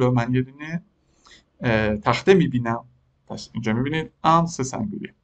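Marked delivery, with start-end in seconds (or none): none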